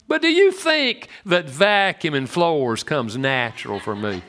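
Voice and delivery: usual speaking voice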